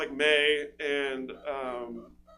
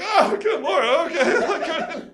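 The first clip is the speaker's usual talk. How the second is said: high, loud, laughing exclamation; shocked reaction; 11 semitones higher, 9 dB louder, much slower than usual. Retold or imitated. Comic recalling his shock at the bra sizes, high loud laughing. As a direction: gasp it out in laughing disbelief. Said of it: "I was like".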